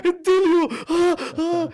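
high gleeful yell; excited shout. Retold or imitated. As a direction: squeal with delight, loud and high.

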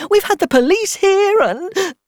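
very high, loud, breaking into sobs; dissolving into tears. A narrator's reading. Distraught mother telling of the police visit, dissolving into tears. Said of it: "She dissolved into tears again".

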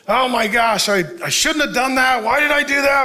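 mock anguished self-reproach; hammed-up agonized regret; regretful prodigal. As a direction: beat yourself up, voice rising. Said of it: "you've rebelled and you've strayed".

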